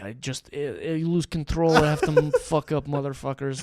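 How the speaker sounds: put-on slow, heavy Russian accent. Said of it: "say it in Russian accent".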